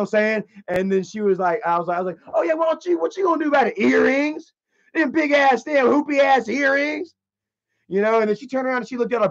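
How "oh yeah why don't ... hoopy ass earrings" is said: high, jeering comeback; mocking taunt. Heckler to woman in dangly earrings, mocking taunt. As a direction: pitch it up and drag out the insult.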